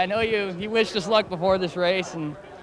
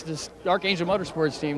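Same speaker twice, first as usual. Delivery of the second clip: usual speaking voice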